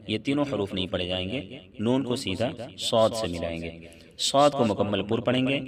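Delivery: ordinary talk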